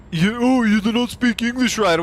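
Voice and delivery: high, jeering imitation of critics; hammed-up scornful whine; mocking shamer. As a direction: mimic a taunt about bad English. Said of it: "those fucking people were trying to shame me".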